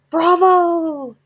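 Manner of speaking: very high, loud cheer; crowd yell. A narrator's reading. The witch audience cheering their leader, very high and loud. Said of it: "yelled the audience".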